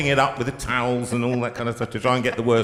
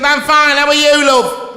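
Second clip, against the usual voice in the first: much higher, louder imitation of a cheery local woman; garage woman's reply; 11 dB louder than usual. Mimicking the woman at the garage answering him, much higher and louder. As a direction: sing out the reply like a friendly shop lady. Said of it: "She said".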